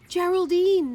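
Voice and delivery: high, loud urgent call; hungry friends calling to Geraldine, desperate. A narrator's reading. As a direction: cry out her name in need. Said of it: "they said".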